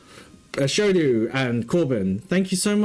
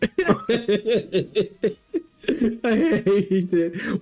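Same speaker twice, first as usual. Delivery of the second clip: usual speaking voice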